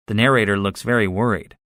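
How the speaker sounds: plain reading voice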